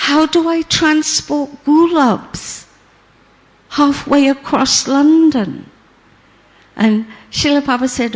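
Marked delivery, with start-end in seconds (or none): 0.1-5.7 worried protest. Retold or imitated. Cook protests impossible request.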